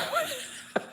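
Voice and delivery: short, very high giggle; real giggle tailing off; laughing. Real, not acted. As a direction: crack up briefly. Laughs at his own joke.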